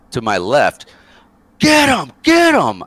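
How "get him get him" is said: high, loud egging-on shout; yelling. Retold or imitated. Drunk buddy Book eggs on a fight, imitated yelling. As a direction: imitate a buddy hollering from the sidelines. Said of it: "I hear Book yelling".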